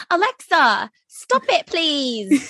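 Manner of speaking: high, prim British plea to Alexa; mock British accent, pleading with the smart speaker. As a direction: ask nicely in a posh-sounding British voice. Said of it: "only listens to me when I use a British accent".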